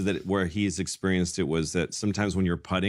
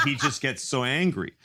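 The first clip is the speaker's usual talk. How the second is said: ordinary talk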